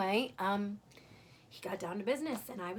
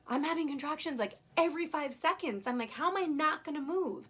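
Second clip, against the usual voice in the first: higher-pitched, emphatic disbelief; acted exasperated protest; 5 semitones higher than usual. Patient in contractions protests to anesthesiologist, high-pitched exasperation. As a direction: protest as if it's impossible. Protests being told to hold still.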